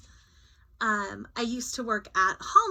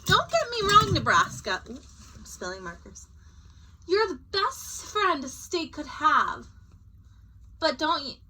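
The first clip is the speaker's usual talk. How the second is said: higher, earnest appeal; longing to see more; Kansas pleading; 7 semitones higher, 4 dB louder than usual. Acted. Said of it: "said Nebraska".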